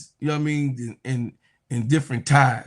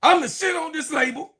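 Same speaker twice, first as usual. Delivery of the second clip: much higher, hammed-up rapper quote; 12 semitones higher than usual. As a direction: belt it way up high like a rap ad-lib. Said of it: "Like [name] said".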